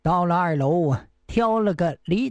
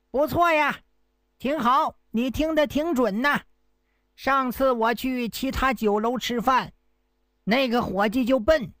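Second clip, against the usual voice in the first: higher, overacted deaf man explaining himself; pretending to be deaf; 5 semitones higher than usual. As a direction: play a fake deaf man making a show of it. Hero keeps up fake deaf act.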